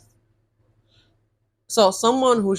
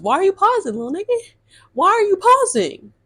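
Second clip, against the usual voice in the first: high, louder "why are you pausing"; incredulous demand; 12 semitones higher, 3 dB louder than usual. Retold or imitated. To her silent date, a high incredulous demand. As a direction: press him hard for pausing.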